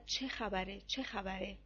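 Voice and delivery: plain reading voice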